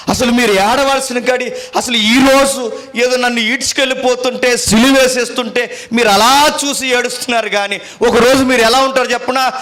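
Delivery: high-pitched, urgent sermon delivery; forceful preaching. Retold or imitated. Christ warns the weeping mothers of coming days, forceful preaching.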